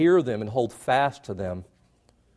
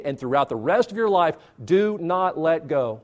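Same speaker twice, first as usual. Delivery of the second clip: slow emphatic plea; 3 semitones higher than usual. Acted.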